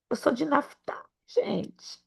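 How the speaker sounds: whispered confession to the church; confiding whisper. Real, not acted. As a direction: lean in and whisper it. Confiding aside.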